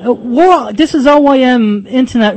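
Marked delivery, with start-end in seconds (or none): none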